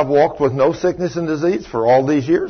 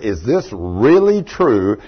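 ordinary delivery

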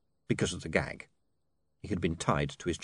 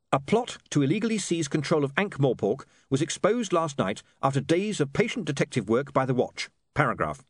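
higher-pitched, formal dictation; editor dictating news; 6 semitones higher than usual. Acted. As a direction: dictate the article phrase by phrase. Voices William dictating an article.

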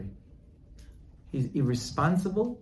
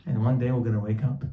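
ordinary talk, nothing marked

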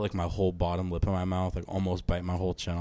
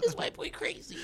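mocking whisper; 7 dB quieter than usual. Mock voice of crowd.

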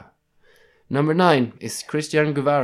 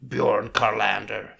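normal talking voice

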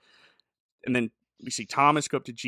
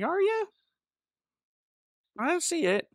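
much higher, feigned astonishment; mock surprise. Jesus mockingly doubting paralyzed James, feigned surprise. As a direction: play dumb, pitch way up. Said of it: "Jesus is like".

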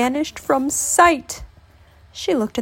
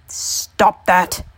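mildly cried-out protest; a touch of an old man's outcry; old man's cry; 3 dB louder than usual. A narrator's reading. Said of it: "cried the old man".

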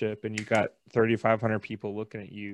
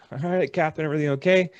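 eager reply; 6 semitones higher, 9 dB louder, faster than usual. Retold or imitated. Answering the captain's call.

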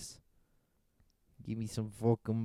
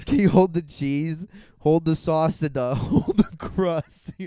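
louder and higher, slowing on the last demand; escalating demands; 7 semitones higher, 11 dB louder than usual. Retold or imitated. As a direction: pile on the requests, laughing. Prank caller escalating demands.

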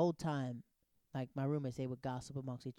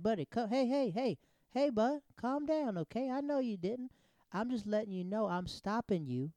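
high-pitched, raised hey-hey-hey; insistent calming; 8 semitones higher, 4 dB louder than usual. Retold or imitated. Cop telling nervous driver to calm down, imitated insistence. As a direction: talk over them to settle them, pitch up.

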